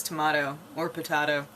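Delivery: tomato with "mah", potato with "tah"; alternative pronunciation. Shown as a demonstration. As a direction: open "ah" in both tomato and potato. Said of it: "I don't know anybody who says".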